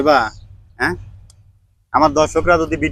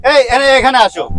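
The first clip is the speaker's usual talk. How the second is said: loud, higher call; yelling across the room; 7 semitones higher, 4 dB louder than usual. Real, not acted. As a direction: shout them over here. Calling kids to come over.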